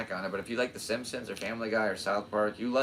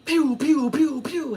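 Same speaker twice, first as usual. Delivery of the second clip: much higher, loud pew pew zaps; pew pew sound effect; 7 dB louder, slower than usual. Retold or imitated. Vlogger imitating a warp drive, high loud pew pews. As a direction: fire off little laser zaps. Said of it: "it'll go".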